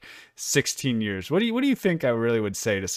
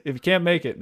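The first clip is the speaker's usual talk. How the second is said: laughing; 8 semitones higher, 7 dB louder than usual. Real, not acted. Laughing at his own bit.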